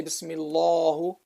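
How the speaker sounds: "Bismillahu" with dark "lah" and u ending; wrongly heavy lam. Shown as a demonstration. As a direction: mistaken thick L in "Bismillahu". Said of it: "or you don't say".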